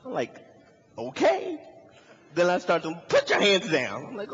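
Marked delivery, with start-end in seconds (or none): 1.0-1.6 high-pitched questioning okay; mock-meek high okay; startled compliance. Startled compliance with shouting cop. 3.1-4.1 loud mock police order; shouting policeman. Cop yelling contrary order.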